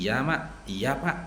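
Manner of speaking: soft, low, submissive reply. Retold or imitated. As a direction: answer meekly, dropping voice and pitch. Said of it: "Lebih turun lagi intonasinya".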